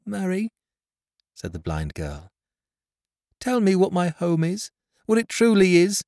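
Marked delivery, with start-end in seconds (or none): 0.1-0.5 put-on a blind girl turning to her friend. A narrator's reading. 3.4-6.0 put-on high, louder girl's plea; pleading blind girl. A narrator's reading.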